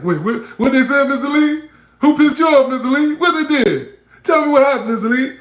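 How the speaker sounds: high, loud, laughing goading; eager egging-on. Retold or imitated. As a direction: egg him on, dying to hear the rant. Eggs on Mister Lee to rant.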